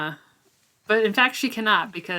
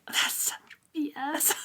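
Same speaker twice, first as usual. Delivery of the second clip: whispered, scoffing aside; conspiratorial whispered scoff; 8 semitones higher than usual. Retold or imitated. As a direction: hiss it under your breath like a secret jab. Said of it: "and I'm like".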